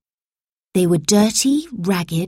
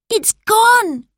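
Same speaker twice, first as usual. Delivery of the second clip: much higher, louder alarm; panicked; 4 dB louder than usual. A narrator's reading. Robert realises the time phone is stolen, panicked and higher. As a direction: blurt out in panic. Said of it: "he exclaimed".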